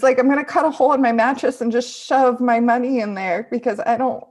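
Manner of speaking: loud, agitated outburst; worked-up student venting; upset rant. Acted. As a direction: blurt it out loudly, overwrought. Upset student rants about her money.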